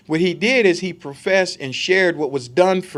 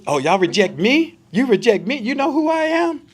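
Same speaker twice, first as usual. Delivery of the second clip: mock indignant God; 6 semitones higher, 4 dB louder than usual.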